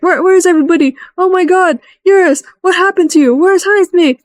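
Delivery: high, fast, panicked calling out; searching the room in rising alarm; frantic panic. Retold or imitated. Finding the van empty, frantic high calls for Jaime. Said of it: "It's like".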